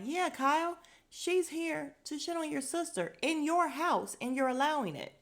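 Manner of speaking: high, loud exasperated jab; mock outraged callout. Host retelling her outrage at Kyle over Rena. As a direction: call Kyle out, fed up. Host's retold outburst at Kyle.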